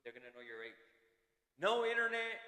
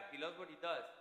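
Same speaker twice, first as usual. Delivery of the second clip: normal talking voice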